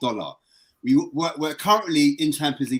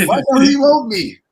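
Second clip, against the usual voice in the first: much higher and louder, near a wail; mock wail; 13 semitones higher, 12 dB louder than usual. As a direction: cry out like the kid the dad never visited. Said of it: "I got upset".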